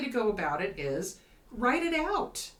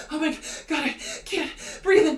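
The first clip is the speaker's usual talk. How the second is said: mock panic; 6 semitones higher, 6 dB louder than usual. Acted panic attack.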